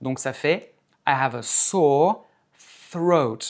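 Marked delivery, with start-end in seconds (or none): 1.1-3.4 SORE held long, then THROAT with a blown TH; careful TH. Shown as a demonstration. Teacher models the sore throat sentence, careful TH demo. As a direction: separate SORE and THROAT, tongue on teeth for the TH. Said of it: "la langue sur les incisives et tu souffles".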